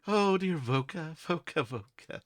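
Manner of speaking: high, teasing fey voice with a laugh; taunting fey creature. Acted. Fey creature calls to the adventurer, high and taunting. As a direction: coo the name like an otherworldly creature.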